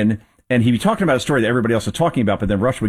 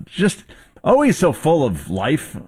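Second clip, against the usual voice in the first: Fran Drescher impression; 7 semitones higher, slower than usual. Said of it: "embraced that accent".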